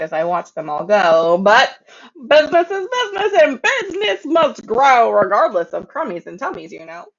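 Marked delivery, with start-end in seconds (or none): 2.3-5.1 high, quick greedy sing-song; greedy boast. A narrator's reading. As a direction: brag it like a greedy tycoon.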